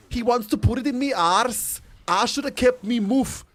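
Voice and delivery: high, loud, hammed-up Northern English accent; British accent. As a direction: wail it in broad Northern English. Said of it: "my favorite British sentence is".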